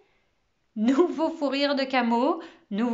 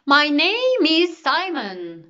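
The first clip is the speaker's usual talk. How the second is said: loud, high, stretched-out proclamation; exclaimed introduction; 5 semitones higher, 8 dB louder, much slower than usual. A narrator's reading. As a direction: announce yourself grandly, slowly, at full voice. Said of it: "s'exclama-t-il". (présentation claironnée, lente et forte)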